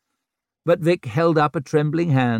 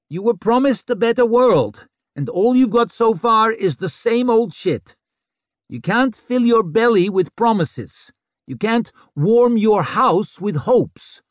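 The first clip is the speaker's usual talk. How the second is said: loud; 10 semitones higher, 4 dB louder than usual. A narrator's reading. Vic addresses crowd.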